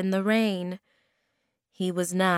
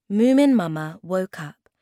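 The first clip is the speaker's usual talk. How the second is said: ordinary delivery, nothing marked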